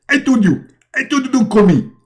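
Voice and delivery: high-pitched annoyed prophet voice; annoyed Muhammad. Acted. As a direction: squeak it out, irritated. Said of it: "and Muhammad, he answer".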